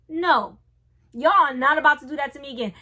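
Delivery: loud, fast, fed-up no; defiant refusal. Retold or imitated. To the nurses, refusing the catheter: loud fast protest. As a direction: shut the idea down hard and quick.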